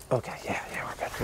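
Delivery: hushed whisper. Real, not acted. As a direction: whisper so nobody hears. Unvoiced, very soft.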